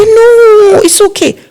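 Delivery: high, light no it's okay; forgiving relatives. Retold or imitated. Family again telling the planner it's okay, high voice. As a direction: wave it away kindly, high. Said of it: "They say".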